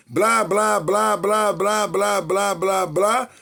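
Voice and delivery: teacher acting out story filler for the class; playful blah blah. Shown as a demonstration. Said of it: "O blá, blá ... é uma onomatopeia".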